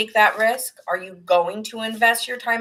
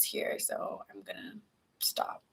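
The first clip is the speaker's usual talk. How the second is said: much softer, whispered, near tears; whispered stop; 12 dB quieter, slower than usual. Real, not acted. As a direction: whisper it as you break off. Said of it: "starting to get a little bit emotional".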